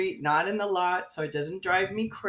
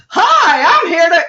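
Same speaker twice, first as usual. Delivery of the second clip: much higher and louder bright hello; loud chirpy greeting; 11 semitones higher, 17 dB louder than usual. Retold or imitated. Staff woman greeting her loudly, high and loud. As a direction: barge in with a loud cheery hi. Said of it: "this woman goes".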